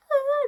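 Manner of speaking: very high, loud short scream; mock scream. Retelling her teen tantrum, a short high loud shriek. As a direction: let out a teen drama shriek. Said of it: "So I was screaming".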